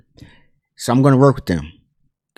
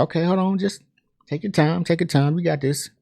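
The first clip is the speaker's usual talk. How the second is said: soft, higher-pitched soothing voice; gentle reassurance; 5 semitones higher, 9 dB quieter than usual. Retold or imitated. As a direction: quietly coax a nervous kid along. Marvin soothes struggling young singer.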